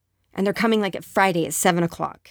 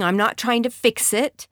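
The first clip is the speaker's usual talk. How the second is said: ordinary delivery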